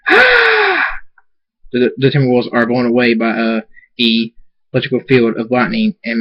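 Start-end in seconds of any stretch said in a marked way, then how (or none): 0.1-0.8 very high drawn-out shriek; sustained scream. A narrator's reading. Thunderstorm unleashing lightning at wolves, screaming. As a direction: hold a long, high scream. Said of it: "Thunderstorm screams".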